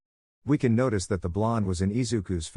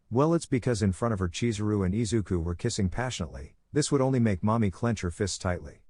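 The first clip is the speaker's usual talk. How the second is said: ordinary delivery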